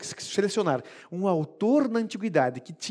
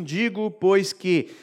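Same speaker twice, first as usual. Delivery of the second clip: lento e solene; 3 dB louder, much slower than usual. Acted. Pregador cita Paulo lentamente.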